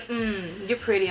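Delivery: put-on low, soft old man voice; hum, then murmur it low; creepy old man. Old man flattering the waitress, creepy and low. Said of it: "He goes".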